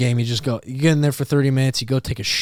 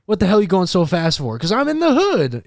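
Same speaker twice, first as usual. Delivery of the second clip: raised, high mock confrontation; imitated TikTok skit; 6 semitones higher than usual. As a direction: act out a heated traffic stop. Acts out a TikTok traffic stop.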